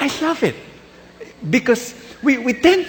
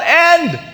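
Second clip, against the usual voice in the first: falling scream; 9 semitones higher, 3 dB louder than usual. Acted. Worker falls to his death.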